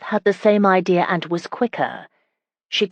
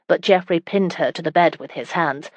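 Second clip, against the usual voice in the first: ordinary delivery, nothing marked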